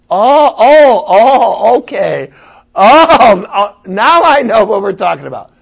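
a touch of a pastor finally getting it, hammed-up oh oh; mock dawning realization. Pastor mimics a reluctant confession coming out, hammed-up realization. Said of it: "they only tell me half the story".

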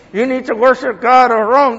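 put-on voice of a talking idol; mock ventriloquist voice. Shown as a demonstration. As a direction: imitate a ventriloquist making a statue speak. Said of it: "they would use ventriloquism".

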